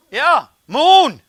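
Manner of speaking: high, loud playful yell; match the kid's joy at full volume; excited shout back. Retold or imitated. Delivery man shouting back to the little boy, high and loud.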